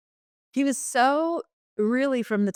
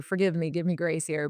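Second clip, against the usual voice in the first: ordinary delivery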